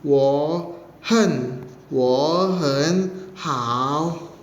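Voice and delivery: "Wo hen hao" each word dipped low and drawn out; Mandarin third tones, very slow. Shown as a demonstration. Teacher modelling Mandarin reply for students, slow dipping tones. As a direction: glide through low third tones slowly. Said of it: "nada ketiga".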